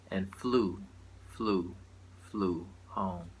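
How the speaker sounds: spoken-word tribute broken into short phrases with pauses; poetry recital. Acted. Poet honoring a Detroit matriarch in verse, measured recital. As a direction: recite in deliberate phrases, settling down toward the ending. Reads tribute poem aloud.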